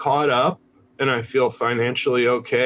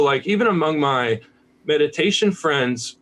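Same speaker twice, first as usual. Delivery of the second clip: normal talking voice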